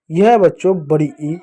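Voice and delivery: ordinary talk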